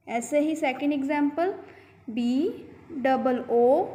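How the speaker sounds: usual speaking voice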